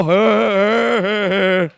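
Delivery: long low mock groan; drawn-out reluctant noise; imitated audience groan. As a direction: groan like a disapproving crowd. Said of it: "Some people".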